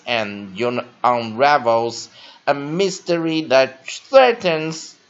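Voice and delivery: loud, slow, carefully spaced English read-aloud; teacher reading aloud. Shown as a demonstration. Teacher reading an article to students, loud and deliberate. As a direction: read the article out loudly, word by word.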